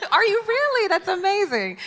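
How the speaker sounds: real amazement after a laugh; delighted surprise. Real, not acted. Moderator reacting to the panelist fronting a band, with delighted surprise.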